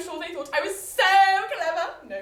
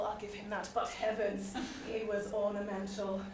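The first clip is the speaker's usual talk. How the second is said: normal talking voice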